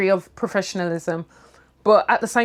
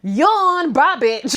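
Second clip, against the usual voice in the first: higher, louder, bored sign-off; mock bored brush-off; 6 semitones higher, 4 dB louder, much slower than usual. Imagined reply to the teacher who shut her down, mock bored. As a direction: yawn him off like you couldn't care less. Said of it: "And I'm like".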